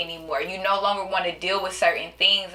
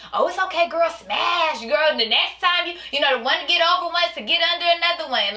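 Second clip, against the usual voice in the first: mock hype friend; 7 semitones higher than usual. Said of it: "You got individuals around you like".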